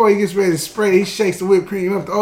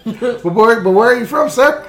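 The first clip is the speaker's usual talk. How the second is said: higher, louder pleading ask; mock innocent question; 4 semitones higher, 4 dB louder than usual. Customer pressing the old man, mock innocent. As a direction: keep pressing, playing dumb.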